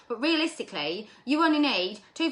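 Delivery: ordinary talk, nothing marked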